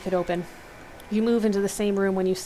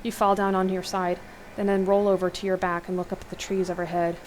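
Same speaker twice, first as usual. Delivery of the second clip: ordinary delivery, nothing marked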